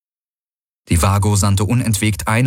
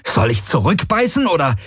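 bitter retort; 8 semitones higher than usual. Acted. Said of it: "ohne seine eigene Wut oder Verzweiflung zu verhehlen".

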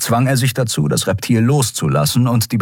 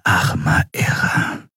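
slow breathless whisper; stunned whisper; slower than usual. Acted. Shiar whispering in shock over the dead prisoner. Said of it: "flüsterte Shiar entgeistert".

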